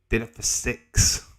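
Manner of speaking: usual speaking voice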